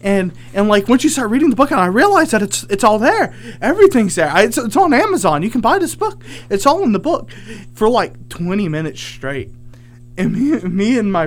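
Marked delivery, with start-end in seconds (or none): 0.0-7.3 high, louder, insistent mock ramble; imitated nonstop rambling. Woman raves to strangers about a book, imitated ramble. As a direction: run on like a stranger who won't shut up. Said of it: "And then she doesn't shut up".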